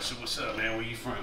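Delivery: lightly imitated rasp, soft and low; Harry's raspy voice. Older dancer greeting a cocky kid, lightly imitated raspy voice. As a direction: murmur it low, a hint of his rasp. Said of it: "He was always talking like that".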